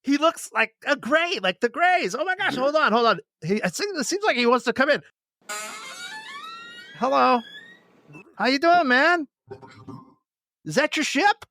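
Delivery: high, thrilled, breathless; excited homeowner. Pretend. Cohost role-playing an alien at his door, thrilled. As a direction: greet the alien at your door, delighted. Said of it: "I'm gonna play the part".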